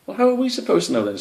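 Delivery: high, fast, exasperated; exasperated hedgehog. Acted. Hedgehog argues with Spike, high and fast. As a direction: rattle it off in frustration.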